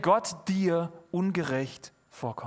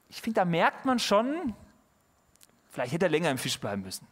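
normal talking voice

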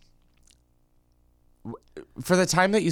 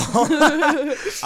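loud laugh; 13 semitones higher, 4 dB louder than usual. Real, not acted. Laughing at awful.

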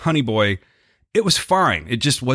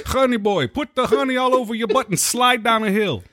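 high, giggling neighbour calling out; a high-pitched, laughing neighbour yelling a nickname; laughing neighbour taunt; 9 semitones higher than usual. Acted. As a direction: tease him through laughter in a high voice.

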